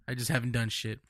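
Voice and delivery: normal talking voice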